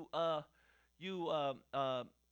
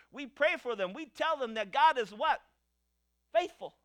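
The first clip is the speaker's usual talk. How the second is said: louder, higher, ringing sermon delivery; fervent preaching; 5 semitones higher, 8 dB louder than usual. Acted. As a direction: lift the congregation up with every line. Preaching at full force.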